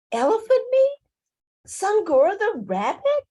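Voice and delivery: high-pitched disbelieving questions; incredulous. Acted. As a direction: squeak it out in astonishment. Leopard astonished at overheard news.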